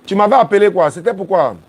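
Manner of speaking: high and loud, pushing over the line; loud on the phone. Real, not acted. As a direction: speak up to a caller. Parle fort au téléphone. (voix forte au téléphone)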